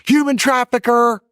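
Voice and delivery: high, loud, drawn-out mock girl voice; mocking girl's accusation. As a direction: shriek it like a snide TikTok girl. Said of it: "she filmed me on TikTok and said".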